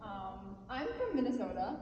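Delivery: ordinary delivery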